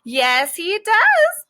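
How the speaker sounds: high squeak on he does; a little pet squeaking back; squeaky insistent rodent voice; squeaky guinea pig. Acted. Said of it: "Minnie squeaks".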